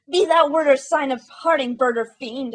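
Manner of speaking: loud, high furious shriek. A narrator's reading. Narrator shrieking at the raven to be gone. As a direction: leap up and scream at the bird. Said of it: "I shrieked upstarting".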